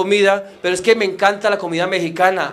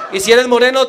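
ordinary delivery

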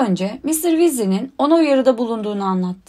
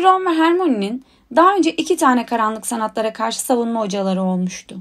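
plain reading voice

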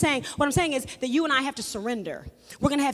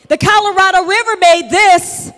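preaching loud; 2 semitones higher, 10 dB louder than usual. Acted. Preacher proclaiming to his congregation, loud and ringing. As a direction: repeat it louder, ringing out.

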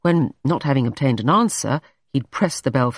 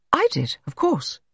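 high-voiced, hasty answer; quick reply; 12 semitones higher than usual. A narrator's reading. Jane claims the seaside idea as hers, hastily. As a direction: jump in with it quickly. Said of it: "Jane said very quickly".

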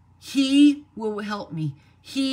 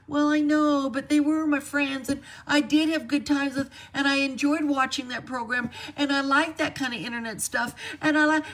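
higher-pitched whiny excuse-making; mock whining; 5 semitones higher than usual. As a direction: imitate someone whining in protest, pitched up. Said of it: "we're all whining and complaining".

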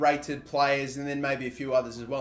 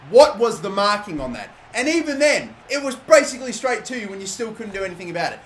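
louder, higher heated complaining; furious rant; 5 semitones higher, 6 dB louder than usual. Real, not acted. Gamer ranting to viewers about the lost final, angry. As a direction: vent at the team, fast and hot. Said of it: "I'm still furious".

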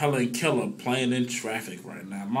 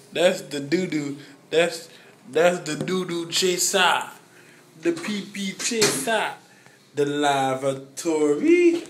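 goofy toilet talk, pitched up; 6 semitones higher, 4 dB louder than usual. Real, not acted. Silly drunk bathroom talk.

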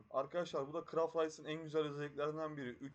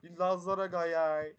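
drawn-out, loud mock Laz accent; 8 dB louder, slower than usual. Shown as a demonstration. Streamer showing viewers how the accent slips, playful Laz accent. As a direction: stretch "gayay" slowly in a Laz accent. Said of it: "benim şive harbi söylüyorum çok garip çalışıyor".

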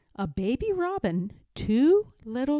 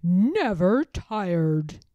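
put-on very slow, louder boast of an old grandfather; proud grandfather; 7 dB louder, much slower than usual. A narrator's reading. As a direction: drag it out proudly, out of the jig. Grandfather after his jig.

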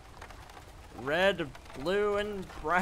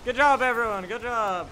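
excited praise; 5 semitones higher, 6 dB louder than usual. Real, not acted. Praising troops after battle.

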